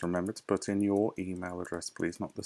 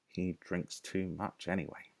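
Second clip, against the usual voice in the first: much softer, under her breath; muttering to herself; 9 dB quieter than usual. Acted. Maid grumbling to herself, much softer. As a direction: grumble quietly aside. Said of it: "speaking to herself now".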